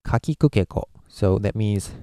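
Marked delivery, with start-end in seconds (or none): 0.0-0.8 ka, ki, ku, ke, ko quick and even; short, connected ka-ki-ku-ke-ko; flat Japanese syllables. Shown as a demonstration. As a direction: link ka, ki, ku, ke, ko without stressing any. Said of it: "if you were Japanese, you would go".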